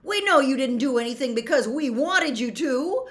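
loud, high, urgent explanation; shouting. A narrator's reading. Harry tries to reason with centaurs, shouting. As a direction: shout to make them listen. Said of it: "Harry shouted".

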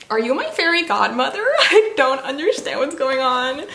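ordinary delivery

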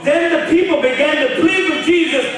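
high, loud preaching call; full-force pulpit delivery; preached loud. Shown as a demonstration. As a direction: boom it out to the congregation. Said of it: "Watch this, you ready?".

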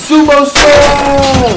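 slow, high, drawn-out wrestling call; announcer shout. Pretend. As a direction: bellow it like a ring announcer. Announcing a game move.

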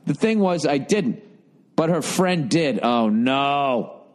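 ordinary talk

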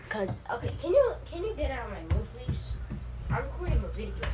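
quiet, quick plea, voice dropped off mic; hushed aside to brother. Real, not acted. Kid softly asking her little brother to leave the room.

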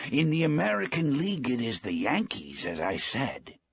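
bright, high and lifted with joy; old man happily talking baseball; a happy lift in his voice. A narrator's reading. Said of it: "the old man said happily".

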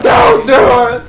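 loud, slow, drawn-out yell; screaming plea. Real, not acted. Yelling at a teammate not to do it, drawn-out scream. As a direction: scream it out long and raw. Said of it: "sounds like a throat cancer".

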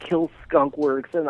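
ordinary talk

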